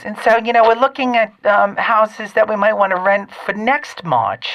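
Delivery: high, affected mother telling a story; mom's affected voice. Retold or imitated. Host retells his mother's house hunt in her affected voice. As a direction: hold an exaggerated high mom voice. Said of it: "without the annoying affected, uh, voice".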